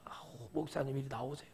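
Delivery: hushed, breathy whisper; whispered aside. Retold or imitated. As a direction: whisper it as if pulling him aside. Doctor whispering to pastor.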